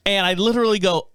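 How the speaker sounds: normal talking voice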